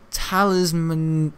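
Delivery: ordinary delivery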